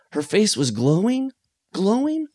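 high, rising mock leer; mock leering guy. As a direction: ham up the creepy guy. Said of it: "when he goes".

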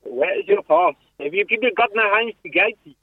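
high, hammed-up South African accent. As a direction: bark it like a border guard in South African. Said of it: "that's the South African accent".

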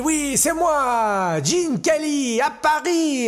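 high, drawn-out mock French; Gene Kelly. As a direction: gush grandly in hammed-up French. Kelly gushes in hammed-up French.

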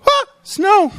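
high, loud fake astonishment; hammed-up homeowner surprise; mock surprise. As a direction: act shocked at the snow. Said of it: "you go".

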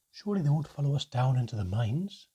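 a frightened monk pleading to the group; faint pleading. A narrator's reading. Brother Desant pleading with the group, faint pleading. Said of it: "His voice had a faint pleading note to it".

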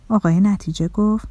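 ordinary delivery

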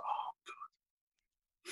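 fed-up unvoiced whisper; whispered aside. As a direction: mutter it under your breath as if nobody should hear. Bored inner thought, to himself.

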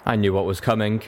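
usual speaking voice

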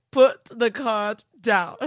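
slow, laughing through the words; cracking up mid-sentence. Retold or imitated. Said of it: "I was screaming".

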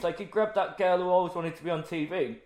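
ordinary talk

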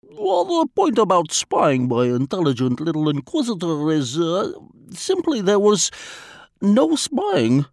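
put-on lightly accented, formal and unhurried Vladimir; a polite spy choosing words with care; foreign diplomat voice. A narrator's reading.

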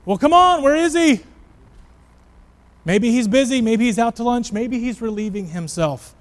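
taunting shout, high and loud, easing off; Elijah. Acted. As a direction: jeer loudly at rivals, goading them. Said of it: "as Elijah is taunting them".

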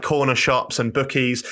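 ordinary talk, nothing marked

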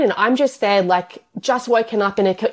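usual speaking voice

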